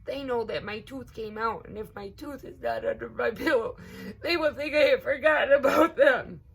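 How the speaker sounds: high, loud and rushing, near tears; tearful child. A narrator's reading. Little girl fretting to her cousin about the fairies, tearful. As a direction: pour out the worry through crying. Rosa crying over lost tooth.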